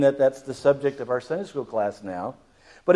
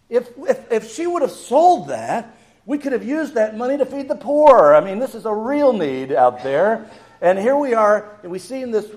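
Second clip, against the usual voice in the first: raised, pressing voice of protest; indignant objection; 6 semitones higher, 6 dB louder than usual. Acted. Disciples complain about the costly oil wasted on Jesus, indignant. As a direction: object strongly, pitched high and getting loud.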